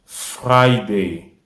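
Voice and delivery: teacher models Friday; model pronunciation. Shown as a demonstration. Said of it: "en anglais se dit".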